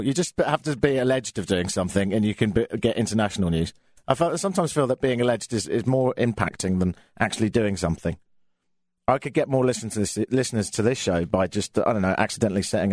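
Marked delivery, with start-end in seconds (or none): none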